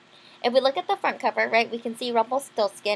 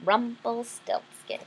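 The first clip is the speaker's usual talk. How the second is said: Rumpelstiltskin drawn out slowly syllable by syllable; slow model name; slower than usual. Shown as a demonstration. Teacher models Rumpelstiltskin slowly for children to repeat. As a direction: sound out Rumpelstiltskin slowly for the kids. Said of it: "Can you say that?".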